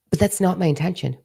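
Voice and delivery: normal talking voice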